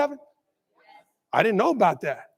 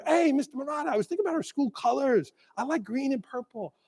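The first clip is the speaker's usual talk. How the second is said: quick, soft imitation of an eager teacher; a hint of an eager staffer; mock eager teacher; 2 semitones higher, 9 dB quieter than usual. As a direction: play the teacher pitching in the hallway, lightly. Teacher pitching ideas in hallway.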